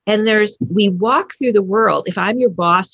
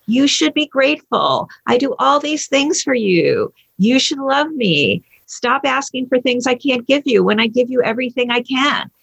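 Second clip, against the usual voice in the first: higher-pitched, aggrieved demands; guilt-tripping; 5 semitones higher than usual. Retold or imitated. Parent reproaching a loved one for ingratitude, guilt-tripping. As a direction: lay on the reproach in a raised pitch.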